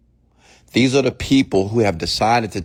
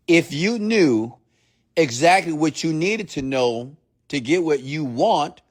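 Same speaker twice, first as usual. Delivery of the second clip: slowly, word by word, higher in pitch; slow, deliberate repeat; 8 semitones higher than usual. Shown as a demonstration. Coach repeating his point to listeners so it lands, slow and deliberate. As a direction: spell the point out slowly and firmly. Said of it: "Let me say that again slowly".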